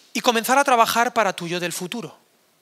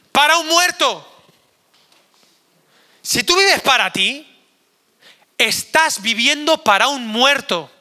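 slow and higher, each word driven home; emphatic preaching; 5 semitones higher, 5 dB louder, slower than usual. Real, not acted. Pastor hammers home working for a dead man, slow and raised. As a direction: preach it slowly, rising in force.

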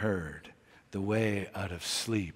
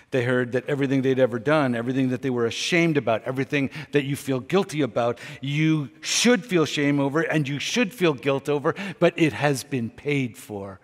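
louder, rushing, the sermon swelling; fervent fast preaching; 4 semitones higher, 7 dB louder, faster than usual. Real, not acted. Preacher urging his listeners, rising fast and loud. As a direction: pour it out faster and louder.

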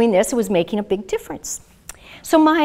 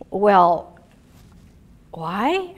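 put-on a touch of a kid's bafflement; hesitant, questioning, imitating a puzzled boy; mildly puzzled; 3 semitones lower than usual. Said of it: "it was really puzzled look".

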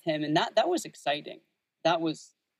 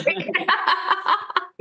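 high, loud burst of laughter; real laugh; 6 dB louder than usual. Real, not acted. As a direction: crack up at the joke. Guest laughs at host's joke.

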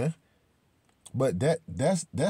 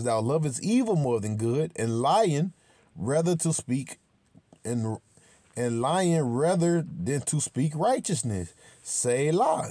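slow, drawn-out preacher reading; scripture reading; 3 semitones higher, slower than usual. Acted. Reading Psalm 52 to his viewers, slow preaching cadence. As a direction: intone the Psalm like from the pulpit.

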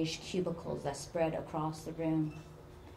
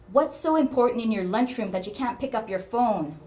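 higher, louder scolding mother; sharp, loud; 5 semitones higher, 8 dB louder than usual. A narrator's reading. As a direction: demand an answer sharply. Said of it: "Her mother's voice was sharper, louder than usual".

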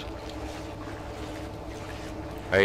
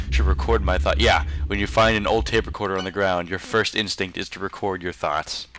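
usual speaking voice

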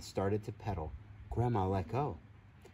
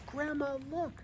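high, thrilled call to grandma; much higher, bursting with pride; excited call; 4 dB quieter than usual. Acted. Gracie calls to Grandma while riding, thrilled and high.

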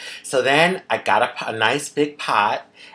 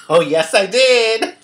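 loud, high, laughing exclamation; gleeful boast; 10 semitones higher, 8 dB louder than usual. Real, not acted. Cook revealing pig feet to viewers, gleeful boast. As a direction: crow it proudly, breaking into laughter.

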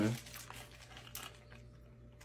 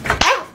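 pain cry; 8 semitones higher, 5 dB louder than usual. Acted.

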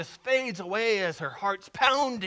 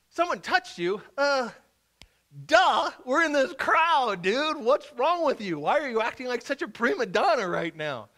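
exasperated; 5 semitones higher, 5 dB louder than usual. Retold or imitated. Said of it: "frustrates him".

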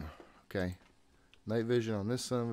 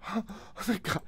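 much higher, gasping out relief; relieved; 13 semitones higher than usual. Real, not acted. Player relieved the ghost spared him, gasping relief. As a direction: let the fear spill out as relief.

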